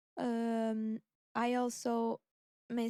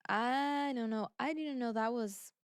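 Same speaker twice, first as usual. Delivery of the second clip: normal talking voice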